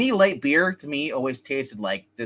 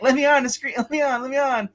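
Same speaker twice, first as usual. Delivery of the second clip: mock pleading; 9 semitones higher than usual. Mimics guest begging to join show.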